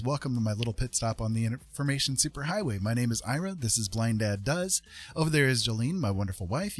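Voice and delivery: ordinary delivery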